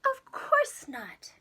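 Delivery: slow, firm whispered no; a hint of a stern mother; mom refusing. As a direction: deny the child, drawn out. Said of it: "his mom replied".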